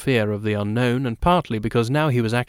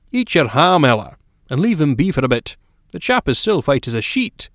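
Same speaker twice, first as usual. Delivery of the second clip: louder, higher man butting in with an order; McGovern cutting across; 4 semitones higher, 5 dB louder than usual. Acted. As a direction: cut across her firmly. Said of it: "McGovern cut across her".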